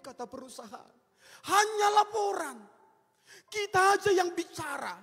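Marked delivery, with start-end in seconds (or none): 1.5-5.0 loud, high rebuke from the pulpit; preacher's loud scolding; emphatic preaching. Real, not acted. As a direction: hammer the point home. Repeats rebuke loudly.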